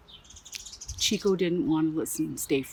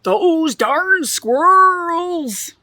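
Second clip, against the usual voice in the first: put-on much higher, loud, very slow old man shout; cranky old man yelling at squirrels; grumpy old man yell; 9 semitones higher, 6 dB louder, much slower than usual. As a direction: shake your fist and holler it. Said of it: "shook his old man fist and yelled".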